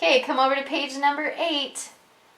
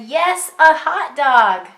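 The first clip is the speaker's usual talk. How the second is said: loud, slow, higher quoted call; Jim's yell; 3 semitones higher, 7 dB louder, slower than usual. Acted. Teacher quizzing a child on who said it, loud. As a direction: repeat Jim's shout slowly. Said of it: "who said".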